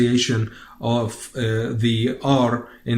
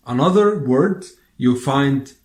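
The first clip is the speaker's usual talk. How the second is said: ordinary talk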